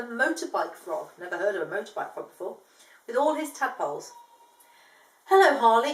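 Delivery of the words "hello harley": loud bright hello; loud greeting. Acted. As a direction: call out hello. Emu greeting frog.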